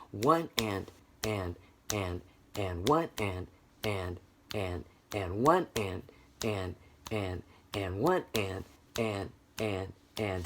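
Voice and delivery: soft "and" on offbeats, "one" slightly stronger; syncopated count. Shown as a demonstration. Teacher leading the class clapping upbeats, gapped rhythmic chant. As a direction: chant "one and", then gapped "and"s in strict time. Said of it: "let's see if we can do that".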